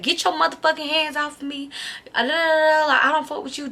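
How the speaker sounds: high-pitched indignant rant, not shouted; retold angry outburst. Acts out fighting him off.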